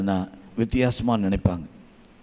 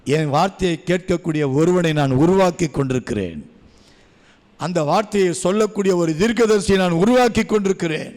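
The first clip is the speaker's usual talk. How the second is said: higher, louder preaching voice; 5 semitones higher, 4 dB louder than usual. Acted. Preacher to the congregation, rising loud preaching. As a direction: build the sermon up with force. Sermon at full force.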